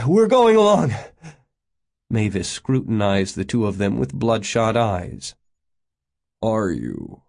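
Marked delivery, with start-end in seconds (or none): 0.1-0.9 gasp, then loud high announcement; insist, winded from running; high, out-of-breath urgency; wheezing. A narrator's reading. 6.4-7.2 put-on low, sour old-man grumble; low, unimpressed challenge. A narrator's reading. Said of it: "peered sourly".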